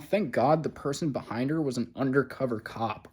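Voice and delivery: ordinary talk, nothing marked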